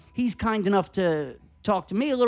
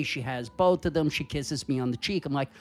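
normal talking voice

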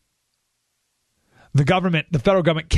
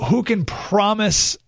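normal talking voice

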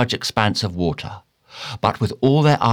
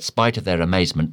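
usual speaking voice

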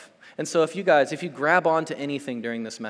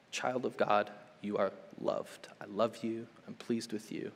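tender hush; 6 semitones lower, 10 dB quieter than usual. Acted. Voicing God's tender words.

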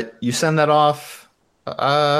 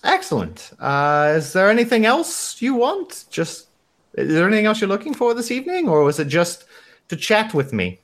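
put-on polite, lilting receptionist, lightly German-accented; Ramona; 7 semitones higher than usual. Receptionist asks what else a guest wants, light German accent. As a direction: ask with rising tunes in a loose German accent. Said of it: "some variation of my shitty German accent".

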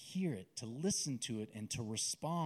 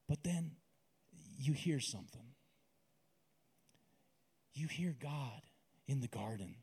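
guided meditation. Acted. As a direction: lead the listener gently into the garden scene. Leads guided imagery prayer.

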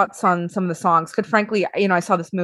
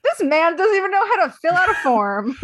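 mock narrator voice; 8 semitones higher than usual.

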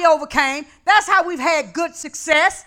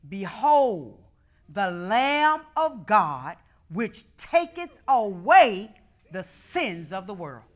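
slow, lowered scripture read; solemn scripture reading; 5 semitones lower, 4 dB quieter, much slower than usual. Real, not acted. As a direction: let each word of the verse weigh. Reads scripture slowly.

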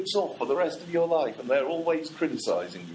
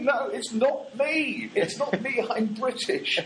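high, slow pleading protest; protesting tourist; 10 semitones higher, slower than usual. Pretend. Holidaymaker pleading with the boots, high slow protest. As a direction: plead innocence, high and drawn out. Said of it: "they think".

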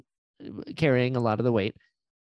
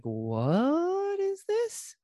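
drawn-out, much higher, puzzled; baffled; much slower than usual. Retold or imitated. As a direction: stretch it out slowly, high and confused. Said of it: "I was like".

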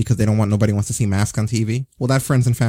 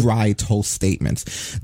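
ordinary delivery

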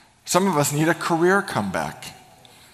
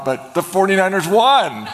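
higher, louder, laughing as he tells it; laughing triumph; 6 semitones higher, 4 dB louder than usual. Real, not acted. Pastor telling the congregation his team won, laughing and excited. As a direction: let the joy of the win burst out. Recalling winning moment.

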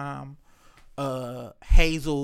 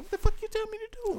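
put-on fast, high, softer kid voice; imitated sassy kid; 7 dB quieter, faster than usual. Grandkid cussing back at granddad, high quick mimicry.

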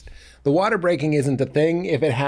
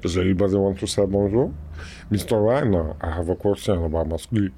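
dim giant cyclops; 4 semitones lower than usual. Retold or imitated. Andre impression again.

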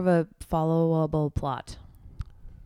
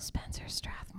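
whisper; 16 dB quieter than usual. Acted. Whispers the name back.

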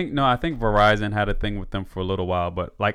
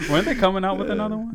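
high, fast laughing question; 11 semitones higher, faster than usual. Real, not acted. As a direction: ask it while laughing. Laughing while asking.

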